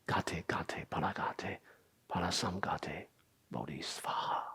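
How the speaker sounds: soft, hushed chant of the syllables; whispered mantra. Shown as a demonstration. Teacher reciting the mantra for students, whispered.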